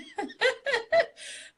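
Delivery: high burst of real laughter. Real, not acted. Laughing at her own hesitation.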